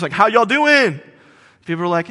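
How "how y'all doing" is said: higher, louder stage hello; hype greeting. Retold or imitated. Opening act greeting the crowd from stage. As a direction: greet the crowd like a hopeful opener. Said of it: "he'll say something like this".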